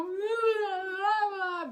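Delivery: high, loud mock-crying blah blahs; imitating her own incoherent crying on the phone; sobbing gibberish. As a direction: blubber nonsense through fake sobs. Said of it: "And I go".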